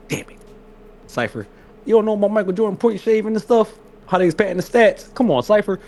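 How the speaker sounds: high, exasperated pleading. Retold or imitated. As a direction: plead with him in disbelief. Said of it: "I said".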